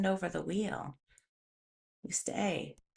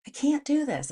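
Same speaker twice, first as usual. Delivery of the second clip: pleading higher, a bit louder; annoyed protest, pitched up; 7 semitones higher, 4 dB louder than usual. Retold or imitated. Telling the trainer she can't lift it, exasperated and higher. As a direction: push the protest up a notch, exasperated. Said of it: "And I'm so annoyed".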